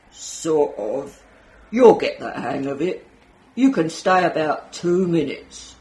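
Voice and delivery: a hint of a gruff guard brushing her off; policeman voice. Policeman at the exhibit answering the nervous visitor, lightly voiced.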